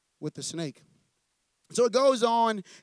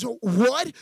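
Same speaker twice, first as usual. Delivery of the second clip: shocked what; 3 semitones higher, 7 dB louder than usual. Acted. Woman shocked by vet's news.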